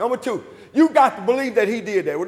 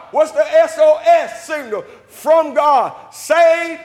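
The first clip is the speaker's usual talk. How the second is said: ringing sermon delivery, stretched key words; full-force preaching; 4 semitones higher than usual. Acted. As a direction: preach it out to the whole church.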